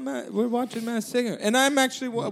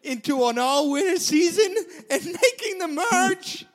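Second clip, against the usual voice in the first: excited; 7 semitones higher, slower than usual. Real, not acted. Thrilled about making the merge.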